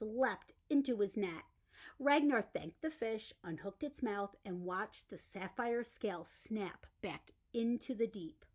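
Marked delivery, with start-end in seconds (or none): none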